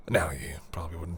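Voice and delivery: soft unvoiced whisper; teasing whisper close to the ear. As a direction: breathe it out as a private tease.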